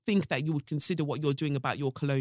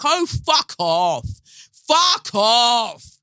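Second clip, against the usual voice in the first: slow scorn, then a louder shout; scornful shout; 6 semitones higher, 8 dB louder, much slower than usual. Retold or imitated. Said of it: "And I was just like".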